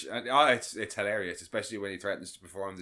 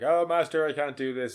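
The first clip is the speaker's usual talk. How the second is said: pleading pupil; 6 semitones higher, 4 dB louder than usual. Acted. Voices Toki panicking to his teacher.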